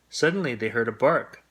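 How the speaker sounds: usual speaking voice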